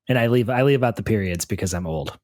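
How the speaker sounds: ordinary talk, nothing marked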